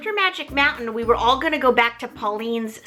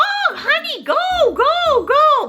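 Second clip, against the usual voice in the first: put-on high, sweet old-lady voice, building louder; Nana's accent; 5 dB louder, slower than usual. Grandma urging granddaughter to go on the date, high and sweet. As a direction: shoo her off like a doting grandma. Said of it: "the cutest little accent".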